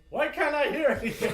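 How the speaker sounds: high, loud, laughing confusion; confused shout. Retold or imitated. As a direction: call out, baffled and laughing. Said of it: "Wait for Gurkhan to be like".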